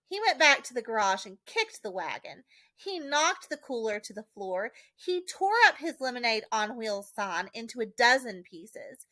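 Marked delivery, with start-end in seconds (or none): none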